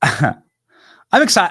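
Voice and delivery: laughing. Real, not acted. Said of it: "What's wrong with this guy?".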